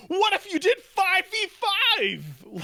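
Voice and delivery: high, drawn-out mock-excited squeal; mocking pitch. Game maker pitching a mad idea to players, high mocking squeal. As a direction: float a silly idea in a slow, high voice.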